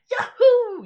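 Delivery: high, loud mock Mario cheer with a laugh; Mario yahoo. Imitating Mario's voice actor cheering. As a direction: shout it like Mario jumping. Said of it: "the guy who voiced Mario".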